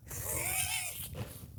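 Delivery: high airless wheeze of a laugh; wheezing laugh. Real, not acted. Laughing at his own punchline, breathy wheeze. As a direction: let a silent wheezing laugh out.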